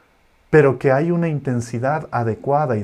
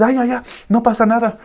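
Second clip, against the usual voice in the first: a touch of high brushing-off comfort; lightly mock a parent waving it away; dismissive comforting; 9 semitones higher, 4 dB louder than usual. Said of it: "No le digas".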